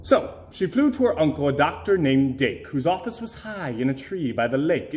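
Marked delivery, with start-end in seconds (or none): none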